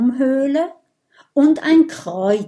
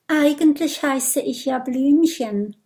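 shy; 5 semitones higher than usual. A narrator's reading. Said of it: "meldet sich das Stinktier schüchtern".

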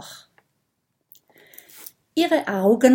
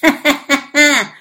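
spiteful higher cackle; gloating snake laugh; 6 semitones higher, 7 dB louder than usual. Acted. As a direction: cackle with malice. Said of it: "lacht die Schlange hämisch".

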